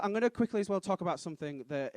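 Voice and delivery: ordinary delivery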